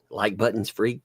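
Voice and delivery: ordinary talk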